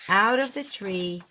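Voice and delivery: ordinary delivery, nothing marked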